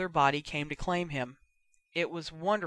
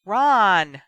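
loud, higher-pitched yell; 4 semitones higher, 12 dB louder than usual. A narrator's reading. Sister summoning her brother, yelling. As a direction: yell the name through the house. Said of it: "she yelled".

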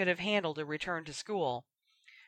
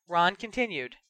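plain reading voice

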